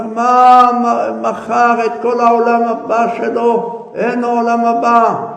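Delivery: loud, high lament; anguished outcry. Acted. Wailing over selling his whole world to come, anguished. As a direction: cry out as if all is lost.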